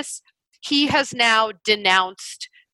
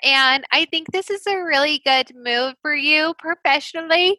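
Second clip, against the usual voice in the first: hammed-up bad acting, climbing higher to the end; mock gushing actress; 6 semitones higher than usual. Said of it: "to go from that accent to that accent".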